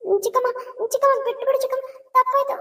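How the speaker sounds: high, desperate pleading. Acted. Niece begs the angry aunt for forgiveness, pleading high. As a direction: beg her to let it go, pitch way up.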